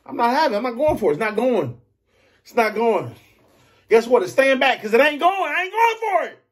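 building defiant high refusal; emphatic refusal. Real, not acted. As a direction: refuse with rising heat. Emphatic refusal, rising heat.

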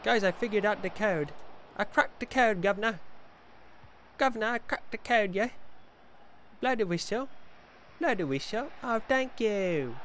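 put-on high, loud Cockney accent; mock Cockney. Gamer telling the guvnor he cracked it, mock Cockney.

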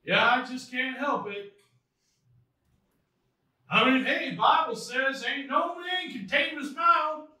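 high, loud southern hillbilly excuse; put-on hillbilly Southern accent. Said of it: "I have a southern accent".

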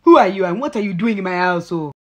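put-on loud, quick African-accented demand; yelling African lady. African lady confronting stranger in her house, yelling in African accent. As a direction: imitate an indignant African woman, loud and fast. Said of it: "The African lady comes out of nowhere yelling loud as shit".

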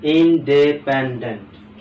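"Independent" loud and slow, each syllable distinct. Shown as a demonstration. Teacher telling students to take note, loudly syllable by syllable. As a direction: call out "independent" firmly in stretched parts. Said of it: "খেয়াল করো".

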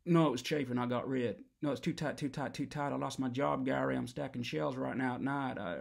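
normal talking voice

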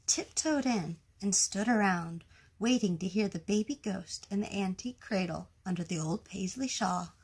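ordinary delivery, nothing marked

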